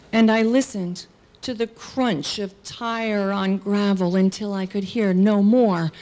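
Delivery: usual speaking voice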